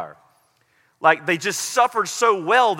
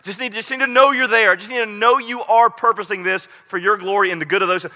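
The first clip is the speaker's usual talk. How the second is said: higher, louder earnest prayer; pleading prayer; 5 semitones higher, 5 dB louder than usual. Retold or imitated. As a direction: beg God to be there. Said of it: "I always ask".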